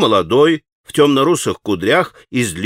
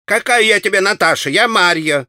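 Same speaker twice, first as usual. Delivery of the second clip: raised, higher, indignant; shrill retort; 7 semitones higher than usual. A narrator's reading. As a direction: snap back at the cheeky boy. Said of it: "крикливо ответила торговка".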